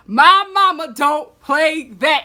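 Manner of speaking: drawn-out sassy warning; sassy repeat. Shown as a demonstration. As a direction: stretch out "My mama" with full sass.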